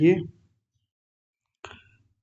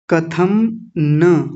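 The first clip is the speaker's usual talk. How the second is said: कथम न ज्ञेयम very slowly; slow Sanskrit drill; slow recitation; much slower than usual. Shown as a demonstration. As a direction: draw out each word for learners. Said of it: "ज्ञेयम नहीं".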